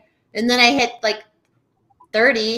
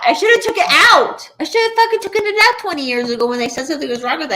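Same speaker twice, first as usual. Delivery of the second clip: louder, higher, fast regretful rant; frustrated outburst; 5 semitones higher, 5 dB louder, faster than usual. Real, not acted. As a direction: blurt it out in frustration.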